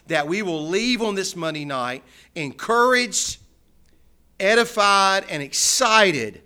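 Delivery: loud, earnest pulpit prayer; public prayer. Real, not acted. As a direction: lift the congregation up to God in a strong voice. Leads the congregation in prayer.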